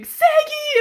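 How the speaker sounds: very high, loud scream; imitated fangirl scream. Little girl standing on her seat at the game, imitated scream. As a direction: scream it like a starstruck little fan. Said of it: "just screaming".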